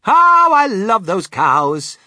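high, loud, drawn-out gush; adoring cry. A narrator's reading. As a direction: swoon out loud with delight. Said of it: "cried Mister Wonka".

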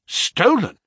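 high, indignant exclamation; shocked cry. A narrator's reading. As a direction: cry out in disbelief. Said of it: "he cried".

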